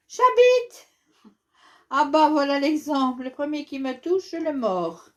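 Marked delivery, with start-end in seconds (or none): 0.1-0.9 J'habite called out high and loud; loud mock catchphrase. Shown as a demonstration. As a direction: belt out J'habite to get a laugh. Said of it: "c'est facile, regarde". (l'enfant montre à papa comment faire rire, J'habite crié fort)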